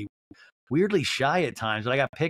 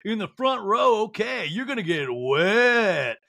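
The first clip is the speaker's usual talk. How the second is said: high, drawn-out announcer holler; exaggerated emcee impression; strip-show MC; 8 semitones higher, slower than usual. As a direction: hype up the crowd, stretching the last word. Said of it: "the M C's like".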